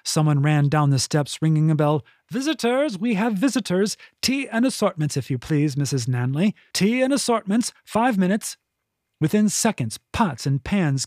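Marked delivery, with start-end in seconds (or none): 2.3-8.6 much higher, brisk announcing butler; butler calling out. A narrator's reading. Butler announcing the guests to the kitchen, brisk call. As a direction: call the orders down the hall.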